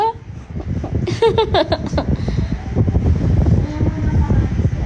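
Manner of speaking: long chuckle tailing off; amused laughter. Real, not acted. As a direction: chuckle on and let it fade. Amused by the child. (mahabang tawang humihina)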